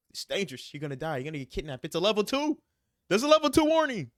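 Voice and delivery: mock mom warnings building to a high, loud cry; escalating mock alarm, pitch soaring at the end; alarmed mother. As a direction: imitate a panicking mom, climbing to a shriek. Imitating mother's escalating travel alarms.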